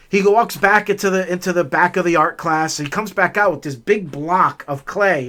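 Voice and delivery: ordinary talk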